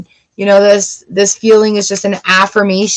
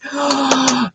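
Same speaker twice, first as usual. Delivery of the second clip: quick gasp of delight; excited gasp; 3 semitones higher, 6 dB quieter than usual. Real, not acted. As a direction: gasp in happy surprise. Excited reaction to reading.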